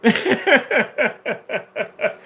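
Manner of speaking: loud, high burst of laughter; real laughter. Real, not acted. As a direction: crack up at your own joke. Laughs at own insult.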